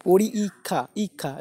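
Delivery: পরি ইক্ষা slowly split, with a separate ই; broken pronunciation. Shown as a demonstration. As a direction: sound out পরি, then ইক্ষা. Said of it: "আমি ভেঙে উচ্চারণ করছে".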